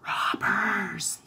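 voiceless urgent whisper; hushed alert; whispered warning. A narrator's reading. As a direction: whisper it secretly into someone's ear. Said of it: "Robin whispered in his ear".

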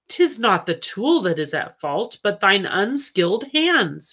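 plain reading voice

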